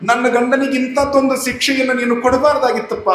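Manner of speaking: high, pleading lament to god in a wife's voice; a wife begging god, high and pleading; grieving wife's lament. Acted. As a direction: cry out to god for your husband.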